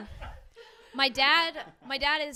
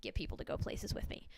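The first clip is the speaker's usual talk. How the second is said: whisper; 6 dB quieter than usual. Real, not acted. Whispered aside to audience.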